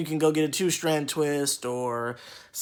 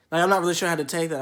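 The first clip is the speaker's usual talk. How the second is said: ordinary talk, nothing marked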